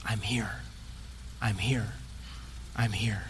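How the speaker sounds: very low, hushed, spaced-out reassurance; gentle whispering. Retold or imitated. Jesus to us all along, hushed low comfort. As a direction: murmur it softly, with long pauses between. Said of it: "he's whispering to us all along".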